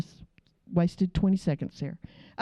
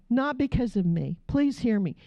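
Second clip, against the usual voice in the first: ordinary talk, nothing marked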